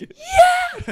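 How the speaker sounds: very high, loud whoop of celebrating fans; mock cheer. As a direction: shriek like a fan with beer in hand. Fans cheering after match.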